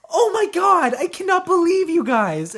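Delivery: high, loud disbelief; exasperated stepmom. Retold or imitated. Stepmom scolding the kids for taking ice cream, aghast. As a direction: throw up your hands in shock.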